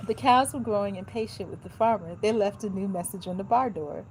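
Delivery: ordinary talk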